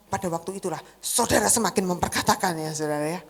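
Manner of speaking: normal talking voice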